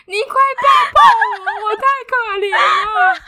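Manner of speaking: high, loud, hammed-up sobbing complaint; crying wail. To her partner after the storm, high loud hammed-up sobbing. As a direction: wail it like a wronged child. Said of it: "这样大爆哭".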